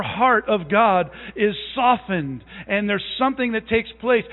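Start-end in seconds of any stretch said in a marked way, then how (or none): none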